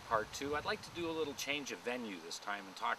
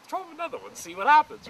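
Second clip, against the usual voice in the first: high, loud, gleeful egging on; giddy urging; 15 dB louder than usual. Retold or imitated. Dad urging his son to throw another peanut, high gleeful shout. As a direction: egg the kid on with glee.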